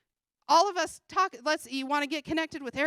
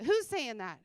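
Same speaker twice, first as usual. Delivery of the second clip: ordinary talk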